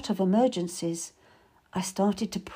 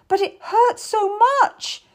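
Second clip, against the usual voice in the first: high, loud, drawn-out wail; slow, pained cry at high pitch; anguished cry; 12 semitones higher, 5 dB louder, slower than usual. A narrator's reading. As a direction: wail it in agony. Mother cries out in agony.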